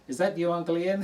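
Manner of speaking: put-on high, loud little-girl voice; little girl's voice. Niece recognising her uncle under the beard, high and loud. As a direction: pipe up like a small sharp-eyed niece. Niece sees through Santa disguise.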